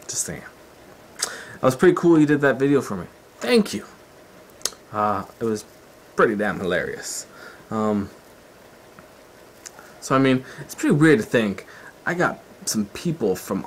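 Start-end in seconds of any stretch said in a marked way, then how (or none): none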